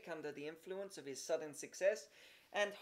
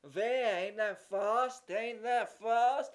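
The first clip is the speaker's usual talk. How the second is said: put-on loud, raised child's voice bragging; boastful boy; 4 semitones higher, 7 dB louder, much slower than usual. A narrator's reading. Horace bragging to the boys, loud boyish boast. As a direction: show off to the crowd.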